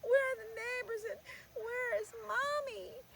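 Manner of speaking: high, loud sobbing cry; a lost little girl wailing for her mother; crying child. Acted. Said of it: "she's just crying".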